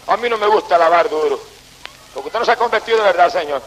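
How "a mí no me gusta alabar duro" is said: mocking quote. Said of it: "alguna gente aquí dice que no".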